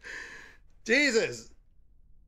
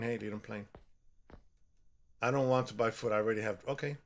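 usual speaking voice

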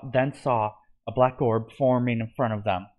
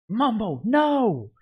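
much higher, louder cry of loss; anguished yell; 11 semitones higher, 4 dB louder, much slower than usual. A narrator's reading. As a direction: scream as your friend is taken. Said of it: "yelled Grian".

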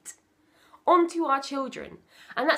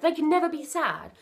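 usual speaking voice

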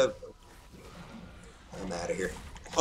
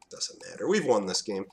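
ordinary talk, nothing marked

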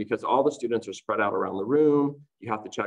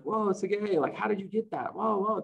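higher, mock-amazed "whoa"; lightly voiced wowed student; imitating amazed classmates; 9 semitones higher than usual. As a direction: play kids impressed by a friend.

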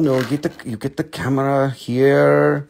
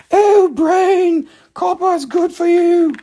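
very high, silly voice for the copper; squeaky mock voice; 4 dB louder than usual. Voicing the copper to your brain, very high and silly. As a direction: pitch it way up like a cartoon.

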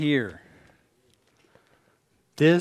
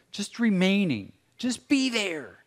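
impassioned preaching; 7 semitones higher than usual. Real, not acted. Preacher building in intensity.

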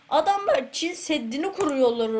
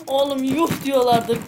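louder, gleeful bragging; loud gloating; 2 semitones higher, 7 dB louder than usual. Real, not acted. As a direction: crow about it loudly. Gloating loudly.

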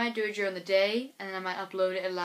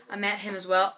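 ordinary talk, nothing marked

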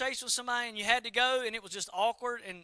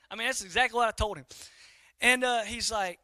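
usual speaking voice

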